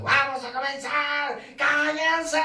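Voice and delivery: high, loud shouting teacher, slow; mock teacher yelling. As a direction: bark over a noisy classroom. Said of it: "yo no me desgastaba gritando". (profesor gritando a cuarenta estudiantes)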